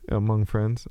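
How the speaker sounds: ordinary delivery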